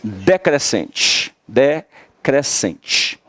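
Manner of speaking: drawn out, syllables spaced slowly; teacher stretching the word. Shown as a demonstration. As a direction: spell it out slowly for students. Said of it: "a gente tem um ditongo".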